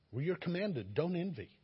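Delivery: usual speaking voice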